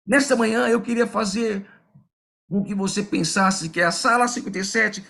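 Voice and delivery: rally the room to go out and shine; preaching. Acted. Pastor urging the congregation to spread the light, preaching fervently.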